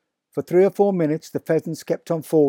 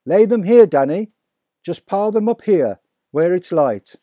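higher and louder, excited call; father calling to son, excited; calling back; 5 semitones higher, 5 dB louder than usual. A narrator's reading. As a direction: call the boy over.

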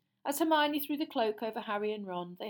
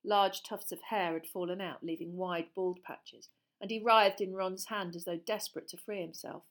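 usual speaking voice

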